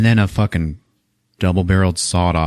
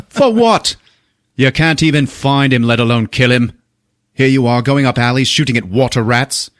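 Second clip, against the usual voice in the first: raised, exasperated scolding; berating chief; 4 semitones higher than usual. Retold or imitated. Chief scolding Stone over water rats, exasperated. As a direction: imitate a boss chewing someone out.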